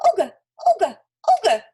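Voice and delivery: very high, loud squeaky mouse call; squeaky squally voice. A narrator's reading. Trapped mouse calling after Olga, squeaky and squally. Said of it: "in a squeaky, squally kind of voice".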